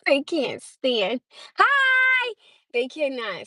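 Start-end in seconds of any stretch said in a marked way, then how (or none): 1.6-2.3 squeaky high, drawn-out hello; a much higher hammed-up greeting; exaggerated hi. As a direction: burst into the room with a hi. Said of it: "If we having a family gathering".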